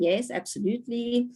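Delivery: ordinary talk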